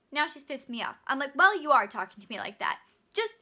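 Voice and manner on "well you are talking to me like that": louder, biting retort; sharp comeback. Retold or imitated. Cashier fires back at rude customer, loud and sharp. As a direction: snap it right back at her. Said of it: "zero to one hundred real quick".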